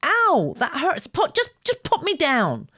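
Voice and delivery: high, loud pained yelps; yelping in pain. A narrator's reading. Girl yelping at the boy carrying her, high and loud. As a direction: cry out, hurt and frantic to be put down. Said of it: "she yelped".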